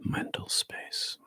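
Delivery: soft, hushed meditation guidance; guided meditation whisper. Acted. Teacher guides the group through body relaxation, soft whisper.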